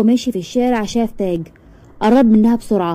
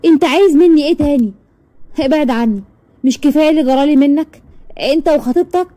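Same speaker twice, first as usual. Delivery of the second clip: angry yell; 6 semitones higher than usual. Acted. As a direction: snap at him to leave her alone. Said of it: "وزعقت".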